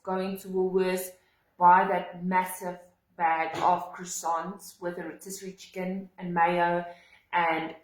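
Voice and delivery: ordinary delivery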